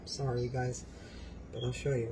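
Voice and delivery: usual speaking voice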